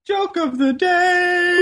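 high, drawn-out segment call; hammed-up game-show style announcement, stretched long; segment announcer. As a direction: sing out the segment title. Announces comedy segment with flourish.

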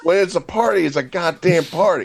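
high, excited party brag; hyped party voice. Pretend. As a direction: pump it up like a party. Bragging about a party.

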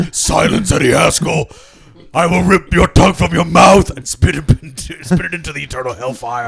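loud, menacing devil roar; booming demon voice. Acted. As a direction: thunder it out like Lucifer. Voicing Lucifer threatening.

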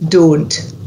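"Don't" with a pure long O; Scottish "Don't", modelled for a learner; Scottish O sound. Shown as a demonstration. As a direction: say "Don't" with one unglided O, each evenly. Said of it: "stick a D on the front of it".

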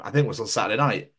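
usual speaking voice

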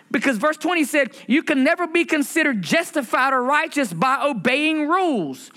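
high, stretched sermon delivery; preaching at full force. Acted. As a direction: lift it up like a pulpit climax. Preaching rises in pitch.

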